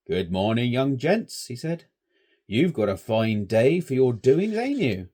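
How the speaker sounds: put-on shepherd hailing the young scouts; old rustic shepherd. A narrator's reading. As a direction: greet the boys like an old countryman. Old shepherd voiced in story.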